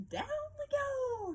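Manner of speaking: very high, slow, whooping lobster cry; gleeful shout. A narrator's reading. Lobster shouting to the crab as they sink, gleeful. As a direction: ride the wave down like a thrill ride. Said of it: "shouted the lobster".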